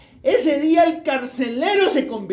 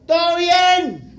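calling voice; 8 semitones higher, 9 dB louder, much slower than usual. Acted. Paul calling to the jailer from inside the cell. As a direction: call out from the dark cell. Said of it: "una voz que le dijo".

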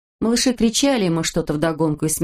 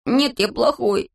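higher, slow whine; sulky self-blame; 5 semitones higher, slower than usual. A narrator's reading. Crying boy contradicts girl comforting him, sulky self-blame. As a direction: drag the words out miserably.

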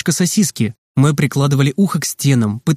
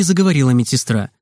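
ordinary delivery, nothing marked

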